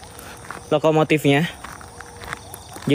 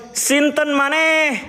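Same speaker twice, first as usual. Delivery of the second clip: slow drawn-out summoning call, higher pitched; replaying his spirit call; 8 semitones higher, much slower than usual. Ghost hunter replays for viewers his slow spirit call. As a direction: stretch out the call as he did. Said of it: "Pas saya bilang".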